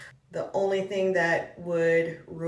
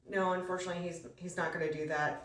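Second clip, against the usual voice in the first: ordinary talk, nothing marked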